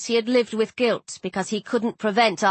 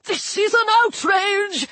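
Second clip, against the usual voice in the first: high, stretched-out indignant cry; scandalised fussy god; outraged official; 11 semitones higher than usual. A narrator's reading. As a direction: splutter in high-pitched outrage. Flustered god protests in outrage.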